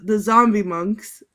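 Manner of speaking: ordinary talk, nothing marked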